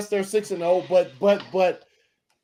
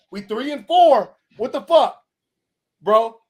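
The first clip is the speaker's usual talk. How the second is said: loud exasperation; 3 semitones higher, 7 dB louder than usual. Real, not acted. Angry rant continues.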